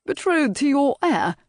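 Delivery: high, incredulous echo of the words; aghast, half intrigued; appalled surprise. Acted. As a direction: pitch it up in shocked disbelief. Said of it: "managed to look both intrigued and appalled".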